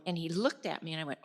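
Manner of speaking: normal talking voice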